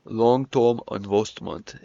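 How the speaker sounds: very slow mock Northern English; exaggerated Northern English mimicry; imitated Northern English accent. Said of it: "Is that how I fucking sound?".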